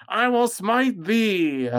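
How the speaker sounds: high, slow holy warrior cry; mock biblical prophet. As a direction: declaim it like a zealous paladin. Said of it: "He goes".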